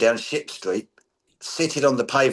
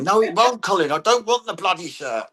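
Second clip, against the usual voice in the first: higher, fed-up protest; mock exasperated refusal; 7 semitones higher than usual. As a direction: push back at a pushy friend. [name] refusing Colin.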